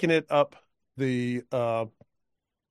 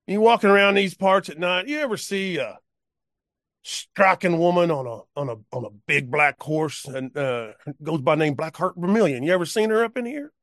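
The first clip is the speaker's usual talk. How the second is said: put-on higher, chatty drunk cowpoke; conversational cowpoke; 4 semitones higher than usual. Cowpoke chatting with his passenger about a rider, high and casual. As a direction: chat like it's nothing, asking a stranger. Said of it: "Very conversationally".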